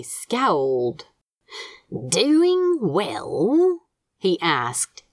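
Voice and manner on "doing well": menacing tone. A narrator's reading. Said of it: "in a tone that had once made a Phalaenopsis orchid die of fright".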